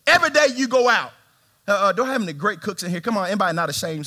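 usual speaking voice

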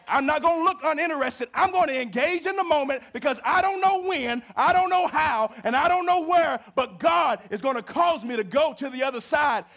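high, loud preacher's cry; full-force preaching. Acted. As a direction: preach it out to the whole church.

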